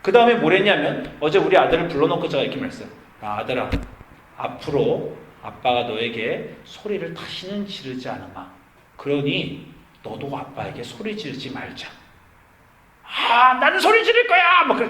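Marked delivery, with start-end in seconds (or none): 3.2-11.9 low, soft, measured appeal to a son; gentle fatherly promise. Retold or imitated. 13.0-14.6 high, loud mock tantrum; defiant child's yell. Son defiantly refuses, acted by father.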